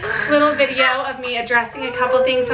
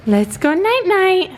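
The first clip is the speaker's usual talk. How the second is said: slow, high, louder sing-song; 9 semitones higher, 5 dB louder, slower than usual. Real, not acted. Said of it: "repeated to baby".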